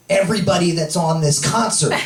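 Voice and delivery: ordinary talk, nothing marked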